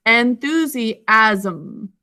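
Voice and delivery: "enthusiasm" slowly, in four syllables; teacher's slow syllable-by-syllable "enthusiasm"; sounded out. Shown as a demonstration. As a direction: break "enthusiasm" into en-thu-si-asm, unhurried. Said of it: "Sound it out with me".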